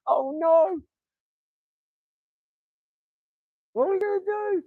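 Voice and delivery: high, panicky mock whimper; mocking scared self. Mocking his own imagined panic at being laughed at. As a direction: squeak it out like a frightened loser. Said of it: "So I don't feel".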